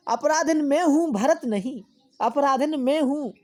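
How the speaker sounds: high, loud poetic plea; dramatic recitation. Acted. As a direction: declaim it as the guilty queen.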